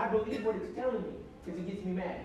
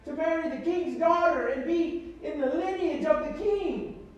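raised, impassioned preacher's cadence; preaching; 4 semitones higher, 4 dB louder than usual. Acted. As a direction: preach it to the congregation, building. Preaching about King David.

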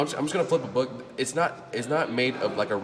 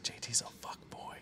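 hushed gossip whisper; 3 semitones higher, 15 dB quieter than usual. Retold or imitated. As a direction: whisper it like a warning. Gossip about J T retold.

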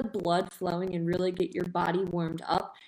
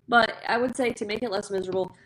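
ordinary delivery

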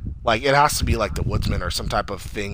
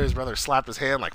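usual speaking voice

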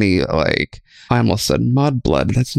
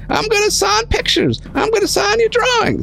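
put-on very high, squeaky old-timey announcer; hammed-up old-time radio pitchman. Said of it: "do it in, like, an old-timey voice".

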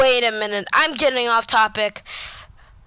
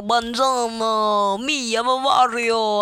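put-on Italian accent; 3 semitones lower than usual. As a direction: slip into a playful Italian accent, stretching the words. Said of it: "I did that in that accent".

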